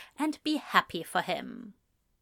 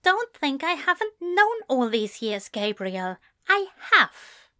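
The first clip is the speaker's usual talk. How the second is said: higher-pitched, restrained anger; through his teeth; 6 semitones higher than usual. A narrator's reading. Man rebuffs friend's confession, through his teeth. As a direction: force it out through gritted teeth. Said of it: "he said through his teeth".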